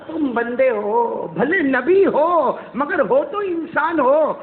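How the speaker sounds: slow, sing-song, stretched words; drawn-out reminder. Acted. God reminds Moses he is human, drawn-out. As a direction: stretch each phrase high and long.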